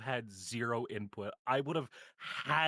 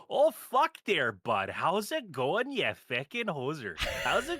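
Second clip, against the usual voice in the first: put-on over-the-top Canadian accent, slow and loud; exaggerated Canadian accent; 3 semitones higher, 5 dB louder, slower than usual. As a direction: play a loud Canadian hoser in a thick Canadian accent. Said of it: "do the Canadian accent".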